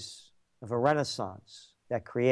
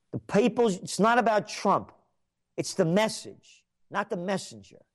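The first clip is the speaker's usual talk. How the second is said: ordinary talk, nothing marked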